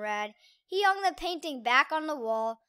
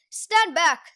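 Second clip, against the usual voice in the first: warning; 4 semitones higher, 8 dB louder than usual. Acted. Cat warns.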